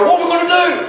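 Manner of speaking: loud, high mock panic; panicked servant outlook. Anxious sibling beside a laid-back brother, panicking. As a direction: blurt it in hammed-up alarm. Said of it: "has the outlook like, uh, the servant".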